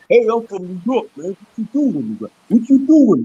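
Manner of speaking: mock New York tough-guy accent; Rocky. Speaker showing how he won't sound, mock New York accent. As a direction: swing the pitch like a street-corner New Yorker. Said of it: "That sound like Rocky or something".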